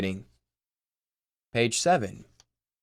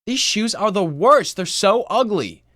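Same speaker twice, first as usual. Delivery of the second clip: much higher, louder whiny shriek; shrieking sister; 8 semitones higher, 7 dB louder than usual. Acted. Sister hurling shoes down the stairs, shrieking. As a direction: wail it like the worst injustice.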